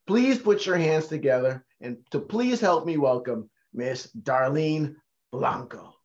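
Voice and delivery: usual speaking voice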